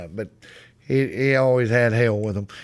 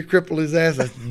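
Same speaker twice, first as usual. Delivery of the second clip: ordinary delivery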